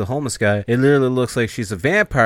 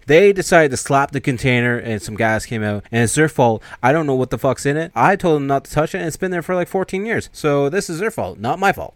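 defensive rant; 5 semitones higher, 5 dB louder than usual. Retold or imitated. Bert defending himself, agitated.

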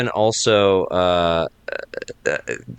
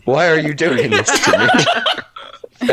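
slow, pleading mock whine, laughing; mock plea; 7 semitones higher, 4 dB louder, slower than usual. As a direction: plead like a baffled Englishman. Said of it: "shaking his head, like".

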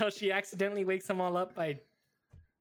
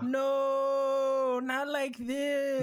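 dismayed; 5 semitones higher, 4 dB louder, much slower than usual. Retold or imitated. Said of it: "And you're like".

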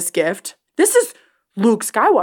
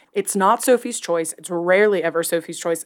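ordinary talk, nothing marked

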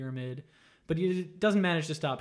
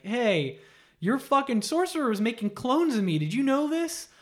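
high, louder accusing rant; angry confrontation; 7 semitones higher, 4 dB louder than usual. Retold or imitated. Katana confronts her dad, a mock furious rant. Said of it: "drags him to Shao Kahn and is like".

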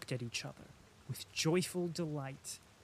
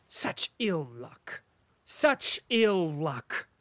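slow lament; 5 dB louder, much slower than usual. A narrator's reading. Denisov lamenting his losses.

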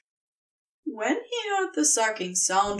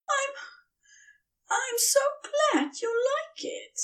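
much higher, halting and choked up; voice thick with emotion. A narrator's reading. Tree thanking the serpent, voice thick with emotion. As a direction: hold back tears of long-buried feeling. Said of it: "voice thick with long-buried emotion".

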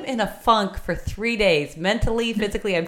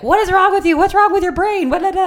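much higher, louder mock yell at a child; parent blowing up; 13 semitones higher, 8 dB louder than usual. Mother imitating how she used to yell at her daughter, exaggerated mock outburst. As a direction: imitate a frazzled parent exploding. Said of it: "blowing up and being like".

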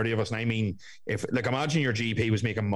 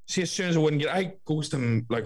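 ordinary talk, nothing marked

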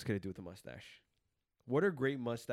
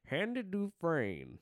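Morgan Freeman impression; 6 semitones higher, much slower than usual. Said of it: "No, you lost it".

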